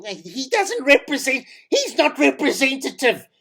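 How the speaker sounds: mock the offended community member; lightly voiced disapproving listener; imagined critic. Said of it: "you're probably thinking".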